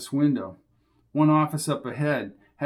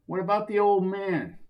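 second thug voice; 6 semitones higher than usual. Acted. Another thug asks.

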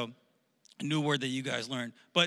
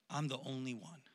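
soft, downcast admission; hushed and forlorn; lonely lament; 3 semitones lower, 11 dB quieter than usual. Acted. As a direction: say it quietly, as if to yourself. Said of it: "he says this phrase".

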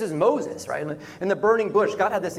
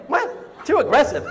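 high unvoiced gasp of "What?" then a louder "Too aggressive?"; incredulous echo; 7 semitones higher, 4 dB louder than usual. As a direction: repeat her words back in disbelief. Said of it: "I'm like".

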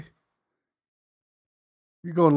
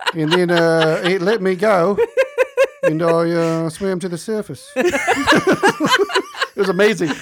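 put-on laughing Australian accent; 3 semitones higher, 3 dB louder than usual. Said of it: "with a very thick accent".

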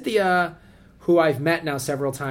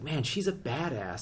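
awed murmur; 9 dB quieter, slower than usual. Retold or imitated. Imitated awed reaction.

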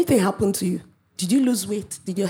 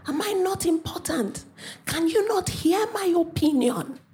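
wailing daughter; 6 semitones higher than usual. Retold or imitated. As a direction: plead like a hurt child, building to a wail. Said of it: "she started wailing".

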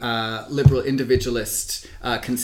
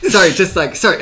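imitating a rapid apologiser, a bit louder; hurried mock apology, slightly raised voice; quick, flustered quote; 5 semitones higher, 8 dB louder, faster than usual. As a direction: rush it out like someone butting in. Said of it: "she was speaking really, really quickly".

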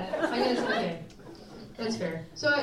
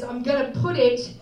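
put-on slow, slightly louder British accent; a light take on an English guy's accent; 5 dB louder, slower than usual. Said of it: "I don't know if it was the accent".

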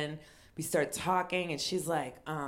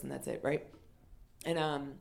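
ordinary delivery

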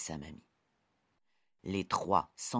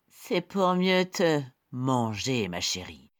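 menacing wolf; 4 semitones higher, 6 dB louder than usual. Acted. Wolf reveals himself.

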